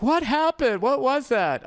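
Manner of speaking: bewildered, calling out; imitating startled desk staff, high and loud; alarmed staff. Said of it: "people at front desk were like".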